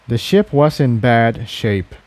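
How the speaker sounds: usual speaking voice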